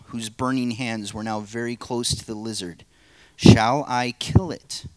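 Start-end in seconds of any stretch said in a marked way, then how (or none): 3.4-4.9 slow, louder question; the angel, firm. Acted. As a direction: ask slowly and firmly, louder. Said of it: "play the narrator and three parts".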